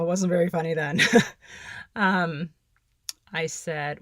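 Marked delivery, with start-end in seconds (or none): 1.0-1.9 rueful laugh. Real, not acted. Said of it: "I can laugh about it now".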